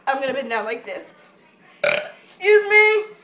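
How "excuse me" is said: sassy excuse me. Pretend. Mock offended at camera angle.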